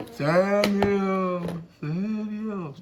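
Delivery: lightly voiced God calling out, fading. God calling the waiting boy Samuel. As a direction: draw out his name from afar. God calls fourth time.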